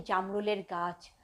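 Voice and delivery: usual speaking voice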